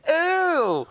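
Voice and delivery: high, loud "ooh"; imitated shriek. Comic imitating a front-row girl at his twerk, mock shriek. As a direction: mimic a girl's loud recoiling cry. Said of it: "one of the girls just went".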